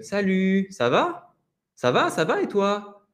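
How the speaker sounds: Salut, ça va? Ça va, ça va et toi with the last syllables drawn out; lengthened phrase ends. Shown as a demonstration. Teacher repeating the greeting so students hear the lengthening. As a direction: lengthen the end of each word group, gently. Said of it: "La fin des petits groupes de mots va être un petit peu allongée".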